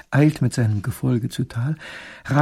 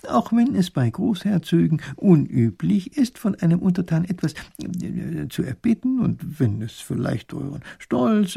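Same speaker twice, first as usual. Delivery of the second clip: higher, slow, halting confidential voice; professor advising discreetly; 7 semitones higher than usual. A narrator's reading. Professor urges the proud duke to ask, hushed hesitant coaxing. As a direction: coax carefully with long hesitant ers.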